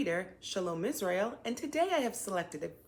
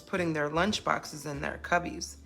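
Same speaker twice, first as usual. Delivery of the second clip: put-on reading to children; 5 dB louder than usual. A narrator's reading. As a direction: read the picture book as if to a child. Reads picture book to children.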